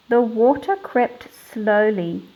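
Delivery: put-on measured read-aloud storytelling for children; story narration. Teacher reading a picture book to her class, storytelling voice.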